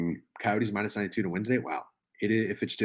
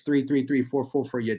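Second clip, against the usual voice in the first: ordinary talk, nothing marked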